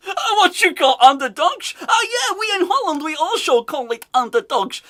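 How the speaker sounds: high, loud, hammed-up Dutch accent; mock Dutch accent. Host mimicking manager on underdogs, exaggerated Dutch accent. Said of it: "He's speaking in a Dutch accent".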